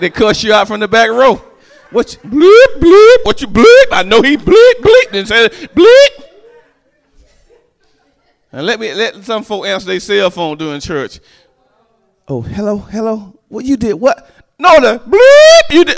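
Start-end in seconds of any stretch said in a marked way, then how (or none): 1.9-6.2 high, loud, furious cussing rant; mock cussing. Churchgoer cussing someone out from the back row, high furious yell. 12.3-16.0 casual phone chat that blows up into a loud high curse; mock phone call. Churchgoer answering cell phone during church, rising to a high angry curse.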